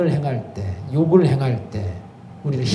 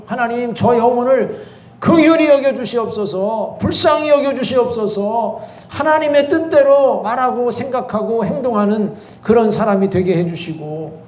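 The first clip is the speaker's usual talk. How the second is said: higher, earnest prayer voice; prayerful plea; 5 semitones higher than usual. Shown as a demonstration. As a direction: pray it out for the one who hurt you. Models a prayer for enemies. (간절한 기도조)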